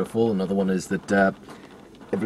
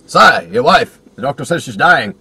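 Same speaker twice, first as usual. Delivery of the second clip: urgent announcement; 3 semitones higher, 10 dB louder than usual. Acted. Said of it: "It's like".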